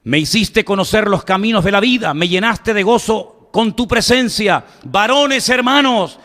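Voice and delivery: emphatic preacher's recitation in measured phrases; ringing public sermon oratory; declaimed scripture. Acted. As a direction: proclaim the prophecy to a whole crowd. Declaims scripture at full force.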